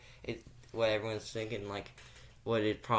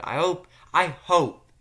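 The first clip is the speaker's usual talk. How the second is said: eager pleading; 7 semitones higher, 11 dB louder than usual. Real, not acted. Eager wish.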